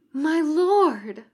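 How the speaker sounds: loud, high and exultant. Acted. Girl greets the summoned demon with loud exultant triumph. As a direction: proclaim it in triumph. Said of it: "the girl's voice was exultant".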